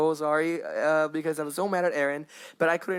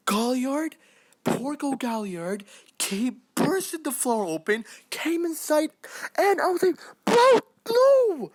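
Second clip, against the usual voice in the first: building from excited narration to a high, loud outcry; rising hype; 10 semitones higher, slower than usual. Real, not acted. Fan telling viewers about Galliard's entrance, building to a high loud cry. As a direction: pitch climbing higher until the cry breaks out. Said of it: "so sorry if I'm so loud".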